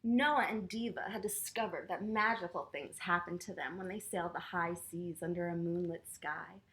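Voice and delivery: ordinary delivery, nothing marked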